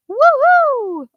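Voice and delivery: loud falsetto woo-hoo, swooping down; high-pitched excited whoop. Shown as a demonstration. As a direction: whoop it out in head voice. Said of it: "give me a high-pitched, excited".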